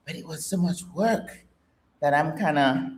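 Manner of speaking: ordinary talk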